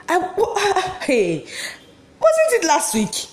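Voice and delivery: high, slow, laughing as she speaks; laughing through words. Acted. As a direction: let the laugh break into the question. Laughs while recalling his joke.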